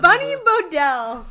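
slow, drawn-out, loud name call; slow announcer voice. Pretend. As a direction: stretch the name like a TV intro. Repeating name slowly.

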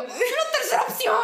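hammed-up kid torn over folders; mock dramatic kid. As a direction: play the anguished little girl. Her childhood self answering dad.

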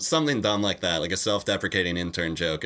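normal talking voice

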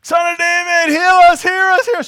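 loud, high pleading shout; blind men crying out. Retold or imitated. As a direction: beg to be heard over the crowd. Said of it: "Can you imagine two blind guys".

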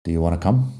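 "Do you wanna come" cut short and run together; quick, linked "d'you wanna come"; connected speech. Shown as a demonstration. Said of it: "hablamos un poco más rápido".

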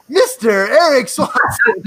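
high, slow showman intro; slow and booming, unveiling the guest; grand announcement. Real, not acted. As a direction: roll out the name like a game-show host. Host announces surprise guest.

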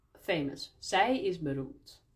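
ordinary talk

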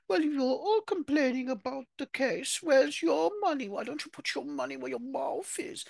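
very high-pitched mocking character voice; Diana impression. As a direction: put on a high little voice to challenge them all. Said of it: "Diana's answer to them all is".

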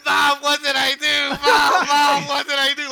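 high, drawn-out mock wail at full volume; kid's panicked wail. Imitating a son beaten over the phone, panicked wail. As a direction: wail like a kid pleading for mercy. Beaten kid wailing, retold.

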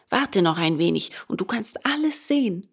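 ordinary delivery, nothing marked

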